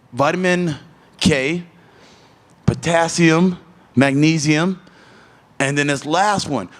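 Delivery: normal talking voice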